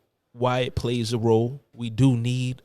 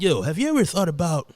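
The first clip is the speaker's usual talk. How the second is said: high-pitched dude voice; friend impression; 7 semitones higher, 4 dB louder than usual. Imitating a friend suggesting a YouTube channel, high dude voice. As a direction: imitate a buddy, pitched right up. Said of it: "Sound like him".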